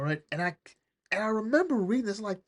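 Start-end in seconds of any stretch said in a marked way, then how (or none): none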